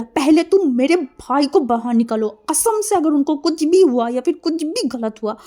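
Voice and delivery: loud, high-pitched furious demand; shouted warning, rising in pitch; angry yelling. A narrator's reading. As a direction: yell a threat at full voice. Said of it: "चिल्ला के देखा and बोला".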